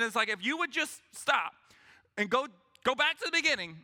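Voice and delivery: normal talking voice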